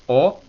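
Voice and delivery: "o" held at mid height; mid-tone demonstration of "o"; middle accent. Shown as a demonstration. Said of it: "acento medio".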